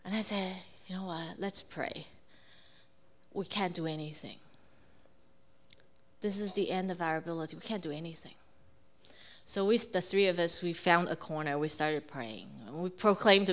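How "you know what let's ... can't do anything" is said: soft, low, surrendering tone; subdued resignation. Retold or imitated. Mother turns upset family to prayer, subdued resignation. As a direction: quietly admit helplessness and suggest prayer.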